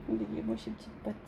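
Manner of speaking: quiet doting murmur to pet; soft cooing. Real, not acted. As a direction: coo over tiny paws. Cooing over her pet.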